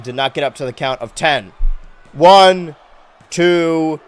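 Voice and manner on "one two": loud, high, slow ringside call; wrestling commentator. Acted. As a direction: stretch the victory call like an announcer. Pin count and comeback call.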